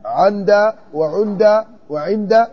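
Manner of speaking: "عند" said slowly three ways: ʿanda, ʿinda, ʿunda; three vowelings of عند. Shown as a demonstration. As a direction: slowly run "عند وعند وعند" through fatha, kasra and damma. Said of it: "إلا إذا قلت".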